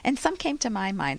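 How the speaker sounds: ordinary talk, nothing marked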